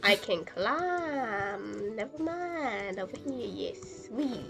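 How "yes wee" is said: ordinary talk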